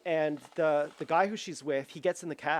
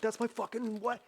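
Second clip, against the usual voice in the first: imitated angry confrontation; 7 semitones higher than usual. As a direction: act out an indignant boyfriend. Imitating an angry boyfriend confronting Tyler.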